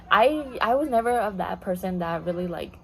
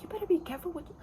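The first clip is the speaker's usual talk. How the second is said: mock yell; 8 semitones higher, 3 dB quieter, faster than usual. Worker warning a rude customer, high mock yell. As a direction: imitate yourself snapping at a customer, pitched up. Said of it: "I, like, yelled at her".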